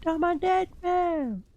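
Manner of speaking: high, slow melodramatic death wail; hammy dying voice. Pretend. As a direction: stretch it out like a stage death. Repeats line as hammy death.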